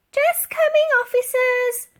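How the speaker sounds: very high, loud sing-song call; old lady stalling the police at the door. A narrator's reading. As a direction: call sweetly to stall them. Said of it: "called out Dotty".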